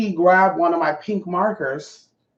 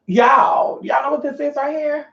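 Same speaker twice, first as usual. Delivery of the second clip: high shouted call to the viewers; excited call-out; 9 semitones higher than usual. Real, not acted. Showing viewers a new bottle, high excited shout. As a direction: shout it out, much higher, thrilled.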